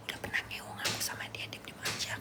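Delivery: hushed gossip whisper; voiceless secretive whisper. Acted. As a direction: whisper it like a secret being spilled. Acts out gossip being spread.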